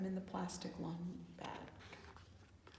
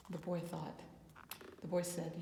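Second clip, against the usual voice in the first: usual speaking voice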